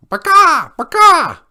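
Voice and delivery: very high, loud squawk; shrill chicken alarm call; screeching chicken. A narrator's reading. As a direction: screech like a panicked hen. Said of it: "this screeching from the chicken coop".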